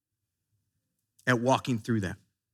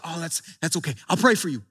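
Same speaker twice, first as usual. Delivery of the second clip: dismissive comforting; 4 semitones lower, 5 dB quieter, faster than usual. Retold or imitated. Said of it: "just be like".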